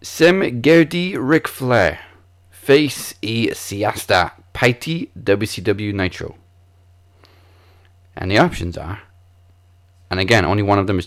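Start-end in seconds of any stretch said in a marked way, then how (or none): 0.0-6.4 slow, careful foreign-language reading; attempted Icelandic. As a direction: sound out unfamiliar Icelandic slowly. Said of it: "This question in Icelandic is".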